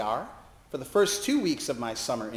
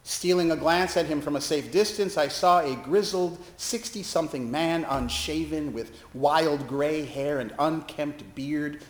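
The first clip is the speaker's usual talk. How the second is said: ordinary talk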